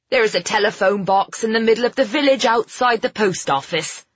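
lightly characterised landlady voice; helpful landlady. A narrator's reading. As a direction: give directions as a helpful landlady.

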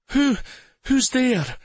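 high, frightened and trembling; a scared, quavering question. A narrator's reading. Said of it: "she asked in a trembling voice".

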